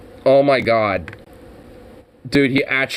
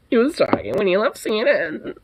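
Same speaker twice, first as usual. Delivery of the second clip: high, exaggerated imitation of a wounded host; mock flamboyant; 6 semitones higher, 4 dB quieter, faster than usual. Mimicking the host a second time, hammed-up flamboyant. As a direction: push the flamboyant mimicry higher. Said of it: "almost flamboyant-like when he was doing that".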